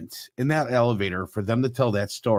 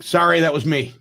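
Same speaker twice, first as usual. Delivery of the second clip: laughing joke; 7 semitones higher, 3 dB louder than usual. Retold or imitated. Deke jokes in crowded elevator.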